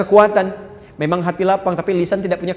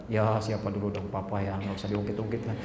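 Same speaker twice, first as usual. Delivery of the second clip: smug husband; 8 semitones lower, 9 dB quieter than usual. Acted. Husband brushing off his wife, lower and smug. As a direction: brag lazily about yourself.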